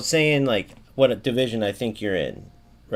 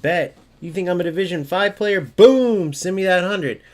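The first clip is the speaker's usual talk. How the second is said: higher and louder, a boastful taunt, BOOM shouted; cocky comeback; 6 semitones higher, 5 dB louder than usual. Retold or imitated. Opponent calling the streamer's bluff, a loud cocky taunt. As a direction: play the opponent daring you to pay up. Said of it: "he'd either, like".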